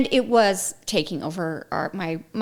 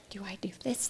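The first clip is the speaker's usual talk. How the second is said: timid retold question; 17 dB quieter, faster than usual. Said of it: "to be like".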